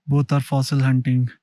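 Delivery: speaking up over wind; loud, low narration outdoors. Real, not acted.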